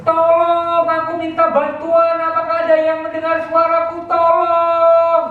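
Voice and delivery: high, pleading cry for help; calling again for help. Acted. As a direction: call out again, still alone in the dark. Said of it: "Dia teriak lagi".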